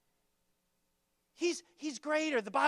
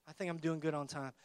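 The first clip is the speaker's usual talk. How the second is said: muttered aside; 8 semitones lower, 9 dB quieter, faster than usual. Real, not acted. Aside about time.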